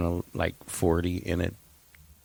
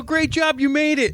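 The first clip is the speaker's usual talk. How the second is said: high, louder cheering voice; mock cheerful stranger; 4 dB louder than usual. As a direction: congratulate her like a proud stranger.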